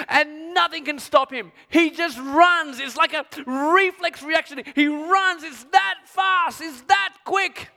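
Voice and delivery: preaching at full force; rising excitement. Acted. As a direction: drive it up to a shout. Preacher building to a climax.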